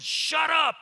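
loud, high, abrupt command; voice from heaven. Acted. Voice from heaven silencing Peter, loud and commanding. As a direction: cut him off with a booming order. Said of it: "this voice came from heaven and said".

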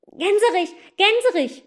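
high, loud urgent shout. Acted. Boy yells up to the gander to keep flying, loud. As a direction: cry out to the goose, hurried. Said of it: "schrie der Junge schnell".

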